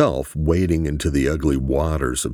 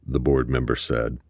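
usual speaking voice

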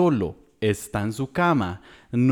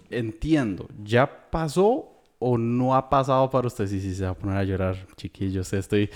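usual speaking voice

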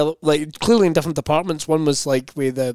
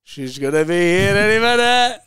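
slow, strained, climbing louder and higher; mock rising panic; 4 semitones higher, 4 dB louder than usual. Stalling with bank card till wife returns, mock panic. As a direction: stretch it out in mounting desperation.

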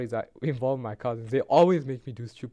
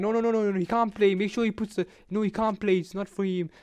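insistent cousin; 6 semitones higher than usual. Retold or imitated. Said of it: "older cousin's like".

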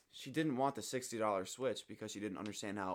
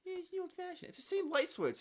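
high squeaky mocking echo. Real, not acted. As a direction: parrot him sarcastically. Mocking repeat of friend's line.

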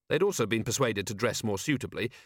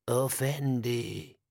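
slow, solemn wise man; measured, dignified elder greeting; desert elder voice; 2 semitones higher, much slower than usual. Acted. Jabbar greets Vimes.

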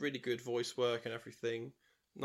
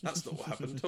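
higher and louder, laughing denial; laughing protest; 6 semitones higher, 4 dB louder than usual. Retold or imitated.

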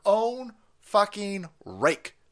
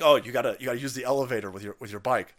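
lower, fast, bored official rattling off a rule; mock staff instruction; 6 semitones lower, faster than usual. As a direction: lower and hurried, like a clerk reciting rules. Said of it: "they're like".

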